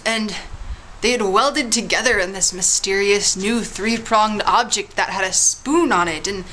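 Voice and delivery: normal talking voice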